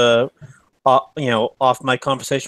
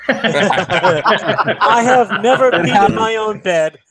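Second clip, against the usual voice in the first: slow, high laughing words; 5 semitones higher, 4 dB louder, much slower than usual. Real, not acted. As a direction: laugh through the line.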